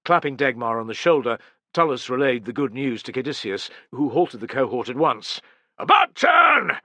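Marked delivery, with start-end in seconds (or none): 5.8-6.8 loud, high, drawn-out parade-ground shout; shouted order. Acted. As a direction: bark it across the whole cohort. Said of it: "Caedicius shouted".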